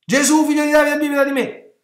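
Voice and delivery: high, loud sustained yell; shrieking plea. Acted. Preacher acts the beggar crying to Jesus, high wild shout. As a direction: yell out for mercy at full voice. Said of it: "però questo strilla".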